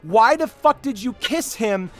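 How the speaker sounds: high, loud scolding at the screen; real nerd rage aimed at the movie couple; furious rant. Retold or imitated. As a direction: yell at the characters in disbelief. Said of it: "and I'm like".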